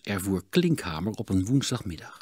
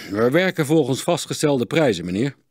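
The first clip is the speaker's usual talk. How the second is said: 1 stiff; 5 semitones higher, 6 dB louder than usual. A narrator's reading. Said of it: "antwoordde Klinkhamer stroef".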